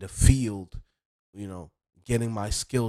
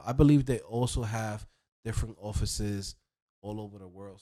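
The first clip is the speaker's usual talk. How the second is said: ordinary talk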